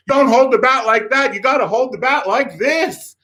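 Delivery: high mock-yelled correction; temperamental coach. Coach showing the kid the right grip, temperamental yelling. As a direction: imitate a worked-up coach, high and insistent. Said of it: "temperamental director".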